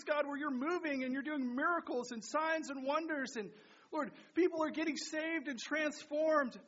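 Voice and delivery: high, loud passionate praying; fervent prayer. Real, not acted. Pastor leads the church in a loud fervent prayer. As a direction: pour your heart out to God.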